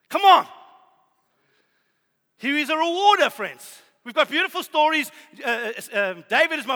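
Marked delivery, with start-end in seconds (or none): 0.1-0.5 loud, high call to the congregation; shouted come on; rallying exhortation. Real, not acted. 2.4-3.3 loud, high, driving sermon climax; impassioned pulpit preaching; full-force preaching. Acted.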